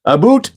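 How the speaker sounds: "A boot." with a long round "boot", said high; hammed-up Canadian "a boot". Shown as a demonstration. Mock Canadian accent for chat readers. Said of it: "for saying a boot".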